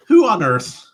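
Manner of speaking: loud, high burst of mock disbelief; incredulous. Author marveling at having bought art, incredulous.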